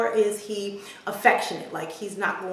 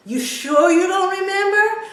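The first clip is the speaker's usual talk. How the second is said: high, loud leading question; coaxing daughter; 11 semitones higher, 8 dB louder than usual. Retold or imitated. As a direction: tease Dad as his eager daughter. Daughter coaxes forgetful dad.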